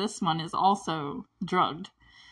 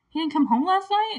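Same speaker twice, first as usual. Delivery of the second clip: higher, louder questioning rise; worried surprise; 10 semitones higher, 4 dB louder than usual. Retold or imitated. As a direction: imitate a worried woman's startled question. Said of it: "she's, like, worried".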